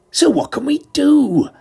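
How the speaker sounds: pitched high, anxious and helpless; a lost man asking for a plan; worried question. Acted. Detective asking his companion, anxious and high.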